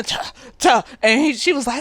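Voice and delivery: ordinary talk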